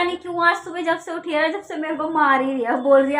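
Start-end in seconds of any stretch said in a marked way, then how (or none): none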